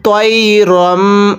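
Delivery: তৈরম drawn slowly, final ম hummed nasally; slow তৈরম with ghunnah; ghunnah on mim. Shown as a demonstration. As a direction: hold the ম in তৈরম as a nasal hum. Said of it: "ছোট্ট মিমগুলো গুন্নাহ করে পড়তে হয়".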